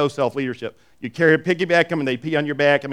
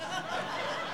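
short breathy laugh; 16 dB quieter than usual. Real, not acted. Laughs at his own story.

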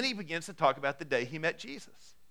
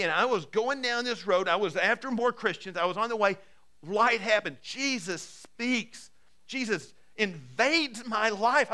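higher, urgent preaching with slowed punch lines; fervent preaching; 5 semitones higher, 4 dB louder than usual. Acted. Preacher to his church, retelling Paul's conversion with fervour.

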